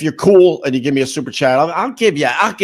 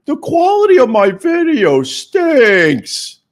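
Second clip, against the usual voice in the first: mock proclamation; 10 semitones higher, 6 dB louder, much slower than usual. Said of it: "I finally said".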